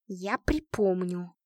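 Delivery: slow, low-key menacing hiss; hissed, spiteful threat; vengeful hiss. A narrator's reading. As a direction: promise revenge, drawing out each word. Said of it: "мстительно прошипела Лика".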